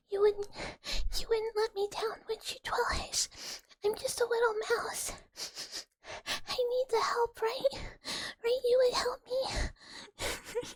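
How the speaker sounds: high teary mouse plea, slowing into a whimper; crying voice. Acted. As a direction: whimper the plea like a fake crybaby. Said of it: "but crying voice makes me".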